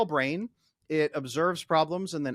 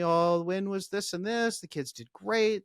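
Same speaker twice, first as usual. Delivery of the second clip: lightly voiced wife, higher and slowed; a hint of his wife, pitched up and unhurried; quoting wife; 8 semitones higher, 4 dB louder than usual. Wife telling husband her day's win, a light higher imitation. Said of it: "And she'll tell me".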